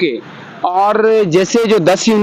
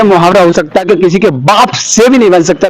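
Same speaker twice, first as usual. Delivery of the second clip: normal talking voice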